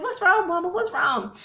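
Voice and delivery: high-pitched worried questions, imitated; alarmed sister. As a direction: mimic a sister rushing in worried. Sister rushing out to crying mother, retold.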